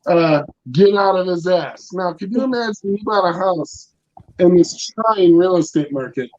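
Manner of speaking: ordinary delivery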